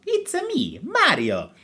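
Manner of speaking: high-pitched cartoon plumber voice; Mario. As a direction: squeal it out like a game mascot introducing himself. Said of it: "Sonic the Hedgehog versus".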